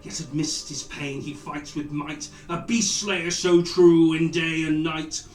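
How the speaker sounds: grand rhythmic bard. Acted. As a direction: proclaim it like a bard. Bard ballad for Ulfgar.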